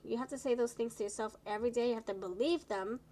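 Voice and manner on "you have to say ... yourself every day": ordinary talk, nothing marked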